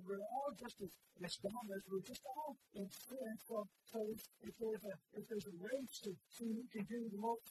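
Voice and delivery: loud, high, fervent preacher's cry; full-force preaching. Real, not acted. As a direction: preach it out over the whole church.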